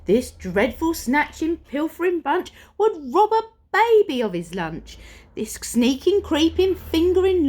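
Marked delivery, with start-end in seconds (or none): none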